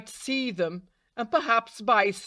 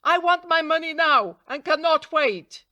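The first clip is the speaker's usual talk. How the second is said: higher, louder angry demand; a small bird crying out in a temper; 7 semitones higher, 4 dB louder than usual. A narrator's reading. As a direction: snap it out angrily like a cheated bird. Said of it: "cried angrily to the raven".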